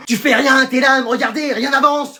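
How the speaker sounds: high, exasperated nagging; mother yelling. Acted. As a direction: scold your kid in a high voice. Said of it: "moi tu me cries dessus". (mère qui enguirlande son enfant)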